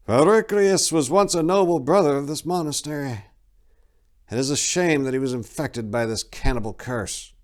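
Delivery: rueful. A narrator's reading. Abbot Gelfus lamenting the fallen brother, rueful. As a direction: mourn a lost brother. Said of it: "The abbot sighed ruefully".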